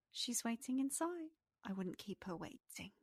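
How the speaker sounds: soft pixie voice, dropping away at the end; a gentle nudge from a little pixie; pixie character voice. Acted. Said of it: "Aliana looks at you and goes".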